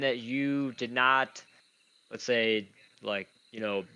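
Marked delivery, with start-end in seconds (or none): none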